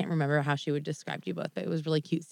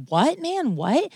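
high, challenging comeback; mock a man rising to a challenge; retold confrontation; 10 semitones higher, much slower than usual. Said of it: "he's, like, screaming at Steve".

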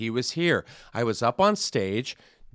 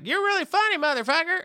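high, excited put-on voice; rowdy fan praise; imitating a cowboy. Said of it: "He was like".

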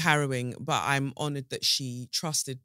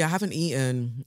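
ordinary delivery

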